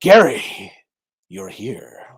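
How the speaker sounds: hammy melodrama; overacted soap drama; soap opera actor. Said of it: "nineteen sixties soap opera".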